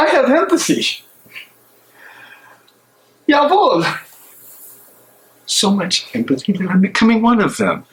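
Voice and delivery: tormented man arguing with himself alone; a conscience breaking under doubt; anguished soliloquy. Acted. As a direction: pace and wrestle with your own guilt aloud. Bonhoeffer's anguished prison monologue.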